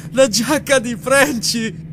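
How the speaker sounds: high, drawn-out crazed scream; screaming like madman. A narrator's reading. Lamley holds his child's jacket, screaming like a madman. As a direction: scream it out wildly, stretching the words. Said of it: "urlando come un pazzo".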